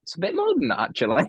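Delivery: high mock voice. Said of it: "and you think".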